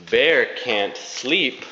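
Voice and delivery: ordinary delivery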